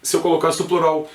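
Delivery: normal talking voice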